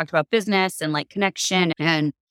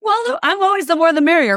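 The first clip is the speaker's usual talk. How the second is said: higher, louder chirpy mock welcome; playful bright the-more-the-merrier; mock cheerful; 11 semitones higher, 6 dB louder than usual. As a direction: sing it out like everyone's invited. Retelling her own inclusive reply.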